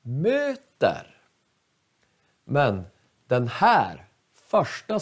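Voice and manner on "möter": Möter with stressed Mö going up, ter falling; Möter, high first syllable then falling; acute accent. Shown as a demonstration. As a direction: lift the long Mö, drop on ter. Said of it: "den går upp".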